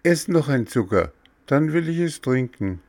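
put-on high-pitched, protesting child figure; high, fussy boy stalling over medicine; whiny puppet boy. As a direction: voice a stubborn little patient, high and insistent. Voices Bengele in the story.

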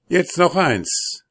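high, slow soldier's call. A narrator's reading. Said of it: "rief der Soldat".

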